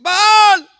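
very high, loud wailing chant; acted frantic invocation; everyone shouting. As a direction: scream it like a crowd of prophets. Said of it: "Y todos gritan".

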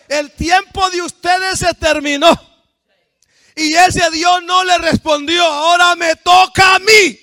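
high, loud triumphant declaration; thundering prophet's challenge; full-force preaching. Acted. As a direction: roar it out, building to the end.

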